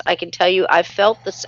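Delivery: usual speaking voice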